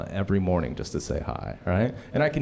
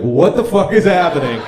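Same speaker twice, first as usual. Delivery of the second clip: shocked disbelief; 7 semitones higher, 10 dB louder than usual. Retold or imitated. Reacting to coworker's remark.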